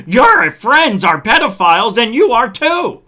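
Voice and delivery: loud heated yell, full word out. Real, not acted. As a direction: shout the accusation at him. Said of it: "I didn't mean to yell at you".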